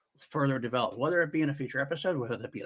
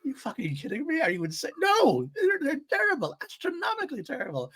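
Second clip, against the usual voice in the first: much higher, incredulous droid voice; a squeaky outraged droid, building higher; imitating Huyang; 14 semitones higher than usual. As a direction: play the droid aghast at the odds. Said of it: "He's like".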